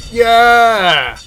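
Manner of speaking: loud, high, drawn-out cheer; big celebratory holler; triumphant shout. Real, not acted. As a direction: yell it in relieved victory. Cheering after surviving in game.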